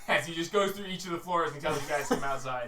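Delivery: ordinary talk, nothing marked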